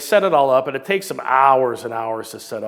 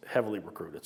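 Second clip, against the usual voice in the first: "heavily recruited" repeated softly, falling away; quiet echo; 5 semitones lower, 8 dB quieter than usual. Shown as a demonstration. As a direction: drop the voice on "heavily recruited" as an afterthought. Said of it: "heavily recruited in those days".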